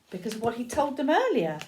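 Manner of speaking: ordinary delivery, nothing marked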